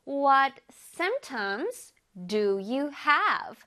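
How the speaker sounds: very slow, careful English; slow teacher demo; slow model reading. Shown as a demonstration. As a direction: spell out each word slowly for students. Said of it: "One more time".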